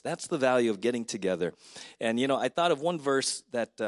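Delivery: usual speaking voice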